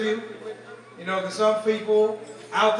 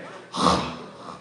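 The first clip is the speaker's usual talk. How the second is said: disapproving sigh. Retold or imitated. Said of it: "he does it again".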